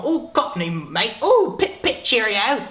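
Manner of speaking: cartoon British accent for the Brits; mock British accent. Teasing British viewers in a mock British accent. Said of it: "for all you Brits over the pond".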